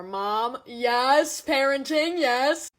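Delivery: loud mock hype; mock cheer. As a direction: cheer mom on, hammed-up. Mock praise.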